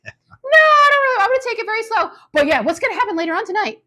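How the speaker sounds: high, whiny mock voice, rushed at times; squeaky over-eager fake reassurance; hammed-up high pretend calm; mocking Elias. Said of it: "So he's like".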